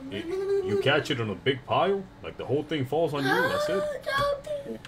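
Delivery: high, laughing rant. Real, not acted. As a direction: laugh it off in a rising voice. Gravel death, laughing.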